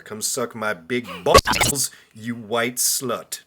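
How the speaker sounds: very slow, drawn-out mock-sleazy read. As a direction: read the creep's text slowly and heavily. Reading creep's message aloud.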